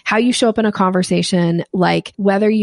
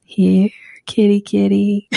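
here kitty kitty call; slower than usual. Shown as a demonstration. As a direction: coax a cat over gently. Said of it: "this little thing where you go".

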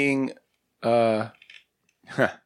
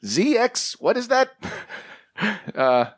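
put-on high old creator voice; 6 semitones higher than usual. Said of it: "He's like".

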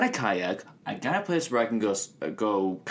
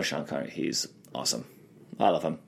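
Sean Connery impression; 8 semitones lower, 6 dB quieter, faster than usual. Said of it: "Are you gonna do Sean Connery?".

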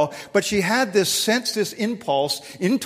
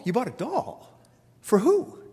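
rising disbelief, higher on "For who"; shocked husband questioning his wife; incredulous; 4 semitones higher than usual. Retold or imitated. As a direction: play the husband who can't believe it. Arguing with wife about doll.

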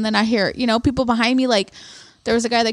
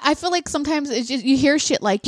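ordinary delivery

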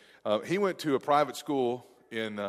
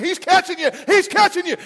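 imitating a much higher, louder shout; dad's urgent yell; 10 dB louder than usual. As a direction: holler it trackside to a racing son. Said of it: "I heard my dad's voice".

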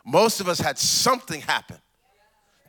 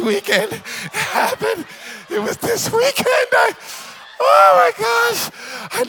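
much higher squealing excitement; giddy gushing friend; 14 semitones higher than usual. Retold or imitated. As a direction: squeal it like a newly engaged girl. Giddy friend announcing engagement.

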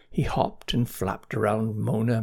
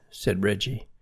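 usual speaking voice

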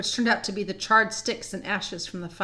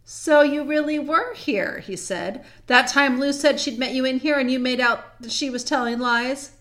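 accusing; 4 semitones higher, 5 dB louder than usual. Acted. Peter confronts Edmund who gave himself away, accusing. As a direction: confront someone caught in a lie.

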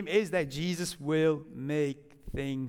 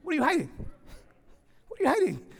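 mock confrontation; 7 semitones higher, much faster than usual. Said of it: "it's like".

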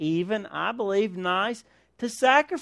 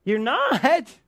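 higher, incredulous echo; mock disbelief; 4 semitones higher than usual. Relative hears they are skipping the exchange, mock disbelief. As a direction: play the scandalized relative, pitched up.

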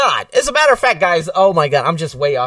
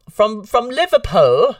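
drawn-out, higher-pitched bad Liverpool accent; terrible Liverpool accent; 9 semitones higher, 4 dB louder, slower than usual. Host jokingly naming Nicole's hometown, bad Liverpool accent. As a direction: put on a badly faked Liverpool accent, slowly. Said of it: "that was a terrible accent".

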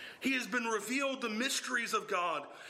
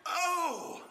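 "oh" को ऊँचा और ज़ोर से, भावुक पुकार की तरह; exclamatory oh; 9 semitones higher than usual. Shown as a demonstration. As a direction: let "oh" burst out high with awe. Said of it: "It's exclamatory. It's emphatic".

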